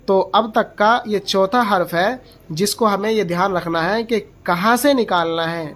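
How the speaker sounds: normal talking voice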